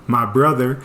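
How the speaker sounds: usual speaking voice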